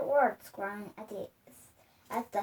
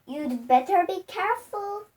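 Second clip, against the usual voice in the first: warning; 6 semitones higher, 7 dB louder than usual. A narrator's reading. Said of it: "Missus Twit said".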